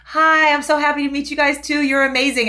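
high, loud, delighted hello; mock gushing greeting. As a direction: gush like a thrilled fan. Acts own gushing reply.

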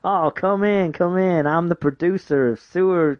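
high fake American producer voice; overdone American welcome, pitched well up; bad American accent. Host invites the players in, bad American accent. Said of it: "worst American accent I've ever heard".

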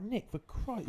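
high-pitched mock telling-off; mock exasperated scolding. Retold scolding of a swearing friend.

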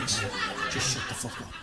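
whispered snap. Real, not acted. Hissed at crew.

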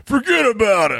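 high barked sensei yell; sharp martial arts commands. Shown as a demonstration. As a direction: yell it like a karate instructor. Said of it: "I'm just gonna be screaming that".

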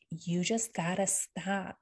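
slow, emphatic imitation; slow it right down, imitating her emphasis; low and emphatic. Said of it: "her voice gets low and emphatic".

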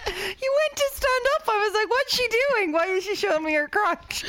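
high-pitched laughing retelling; helpless laughter. Real, not acted. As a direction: squeak the words out through laughter. Laughing through retold alarm.

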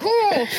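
high gasp, half laughing; breathless gasp. Shown as a demonstration. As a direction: mimic being puffed out from running. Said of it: "get a bit breathless, like I'm running".

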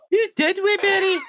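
very high, loud Scottish demand; demanding young Scots. Acted. Maid demanding to know, high loud Scottish voice. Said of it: "she demands to know".

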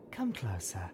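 soft unvoiced whisper. A narrator's reading. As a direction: whisper an invitation to come close. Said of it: "Velvet said in response".

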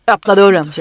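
loud, commanding police order through the door; darkest cop voice. Retold or imitated. A scared woman at the cabin door putting on her loud cop voice. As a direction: play the tough cop she has acted so often. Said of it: "med min mörkaste fan snutröst".

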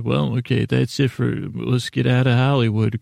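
normal talking voice

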